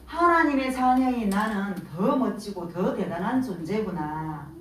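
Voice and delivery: usual speaking voice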